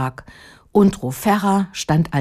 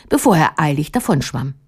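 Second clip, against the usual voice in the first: usual speaking voice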